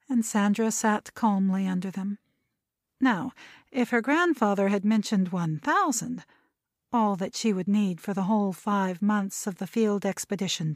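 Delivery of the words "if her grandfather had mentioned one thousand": ordinary delivery, nothing marked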